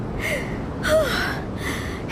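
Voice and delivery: long tired exhale; heavy sigh. Acted. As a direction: sigh out, short of breath. Breathless in the heat.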